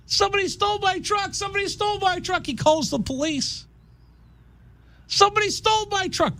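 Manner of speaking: high, fast whiny wail; mock whiny victim. Stranded truck owner whining to the police, high. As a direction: whine it like a helpless victim. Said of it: "standing there".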